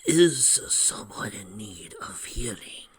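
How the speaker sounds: slow, whispery holy man; quiet voice. A narrator's reading. Robed Lynx offers healing to strangers, quiet voice. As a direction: whisper it slowly and gently. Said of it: "a quiet voice asked".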